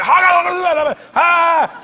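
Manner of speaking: loud, high imitation shout; yelling. Comic imitating the guy yelling in the hallway, loud shout. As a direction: yell it out like the shouting man. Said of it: "This guy starts yelling".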